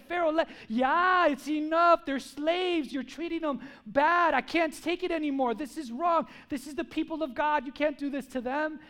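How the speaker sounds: high, loud acted righteous anger; imitating a prophet's fed-up protest; indignant outcry. Acts angry Moses confronting Pharaoh.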